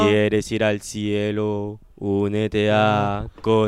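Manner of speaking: low, slow sermon plug; mock preacher. Cohost preaches the paid membership, low and slow.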